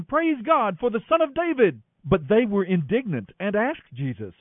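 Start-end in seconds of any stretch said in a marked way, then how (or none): 0.0-1.8 high excited shout; children shouting. A narrator's reading. Children in the temple praise Jesus, shouting high. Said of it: "little children in the temple shouting".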